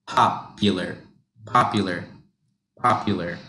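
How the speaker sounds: popular said as one smooth word; joined together. Shown as a demonstration. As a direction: keep pop and ular together. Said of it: "don't separate pop and ular".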